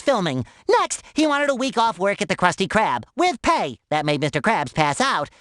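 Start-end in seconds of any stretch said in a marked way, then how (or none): none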